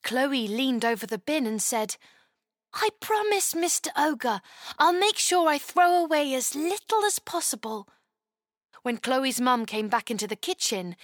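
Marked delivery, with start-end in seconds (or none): 2.7-7.9 put-on high, eager little girl; curious child asking how to help; young girl voice. Chloe promises ogre.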